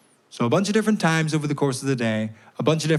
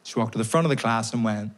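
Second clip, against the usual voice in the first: ordinary talk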